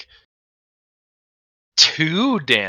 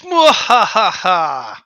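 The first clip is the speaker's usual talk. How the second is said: evil laugh; 4 semitones higher, much slower than usual. Acted. GM villain laughs at the party.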